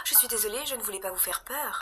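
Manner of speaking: unvoiced whispered apology. The stranger apologising to Ava, in a whisper. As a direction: breathe it out, barely voiced.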